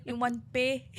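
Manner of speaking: put-on laughing through a Thai accent. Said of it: "I did the Thailand accent".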